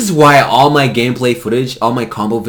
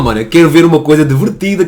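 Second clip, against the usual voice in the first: ordinary talk, nothing marked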